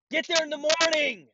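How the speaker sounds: exasperated high-pitched holler; outraged shout. Acted. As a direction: scream it at the absent customer. Ranting back at absent customer.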